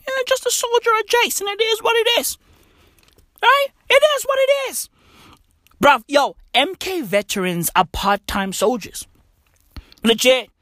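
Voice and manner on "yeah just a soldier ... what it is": mocking squeak.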